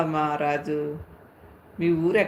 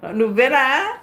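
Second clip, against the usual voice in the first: surprised; 7 semitones higher, 6 dB louder, slower than usual. Real, not acted. Recognising a viewer, startled.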